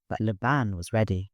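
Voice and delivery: usual speaking voice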